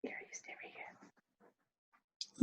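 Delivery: slow urgent whisper. Acted. Whispering to someone to stay hidden. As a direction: breathe it, barely voiced. Hushed order to stay put.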